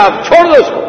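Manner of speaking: high firm command to release her; commanding order. Acted. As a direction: order them to let her go at once. (حکم دیتے ہوئے، بلند آواز)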